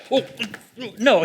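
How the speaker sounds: lightly hammed-up "oh, no" outburst; mock panic. Preacher acting out what Jesus wasn't like, mock panic. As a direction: play a silly, high little panic. Mocks a reaction Jesus never had.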